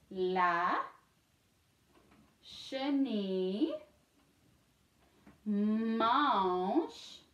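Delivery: slow, word by word with rising ends; dictation for repeating. Shown as a demonstration. Teacher reads first for the class to repeat, slow dictation. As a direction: read haltingly for pupils to echo, long gaps between words. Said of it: "I'm gonna read it first".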